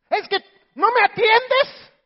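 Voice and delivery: high, loud whining complaint; whiny husband complaint. Acted. Husband in the pit complains he is ignored, high whine.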